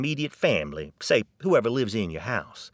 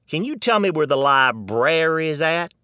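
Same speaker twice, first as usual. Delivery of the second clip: loud Texan retort with a sting on jackass; Texan answering the Harvard man, loud and cheeky; Texan comeback; 6 semitones higher, 4 dB louder than usual. Retold or imitated. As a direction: give it right back, Texas-style. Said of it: "So the Texan says".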